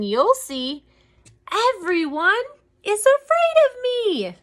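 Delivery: slow, drawn-out bragging that climbs high at the end; boasting mouse. Acted. Mouse warning the Gruffalo, slow theatrical boast. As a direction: brag with storybook bravado.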